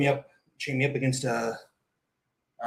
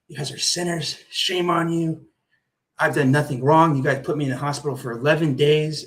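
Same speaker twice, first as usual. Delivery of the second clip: retold yelling; 3 semitones higher than usual. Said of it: "I would scream right back at them".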